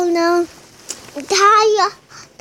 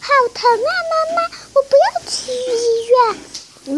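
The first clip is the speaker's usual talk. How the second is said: put-on high, fast, wailing child voice; a scared kid begging mom; child wails he won't have surgery, high and crying; 11 semitones higher, faster than usual. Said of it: "一边说一边喊".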